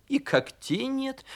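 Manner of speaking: high, sighing remark; light, high-voiced sigh. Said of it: "вздохнула звезда".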